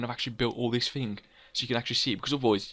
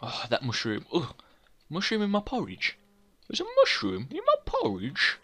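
disgusted porridge eater; slower than usual. Pretend. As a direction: squeak in disgust at a mushroom in the porridge. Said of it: "you're like".

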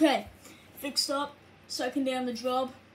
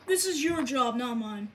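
ordinary delivery

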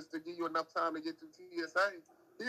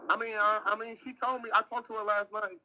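higher and louder, quick to defend; defensive burst; 6 semitones higher, 4 dB louder than usual. Real, not acted. Defending Kayla to his teasing friend, higher and louder. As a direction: push back against the teasing.

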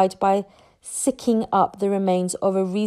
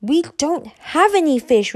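exasperated, higher and louder; through gritted teeth; 7 semitones higher, 5 dB louder than usual. A narrator's reading. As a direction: strain to stay calm with a pest. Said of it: "from between gritted teeth".

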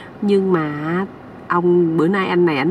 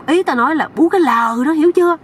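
raised, emphatic pitch and volume; impatient explaining; 7 semitones higher, 3 dB louder than usual. Acted. Uncle clarifying for the young man, loudly impatient.

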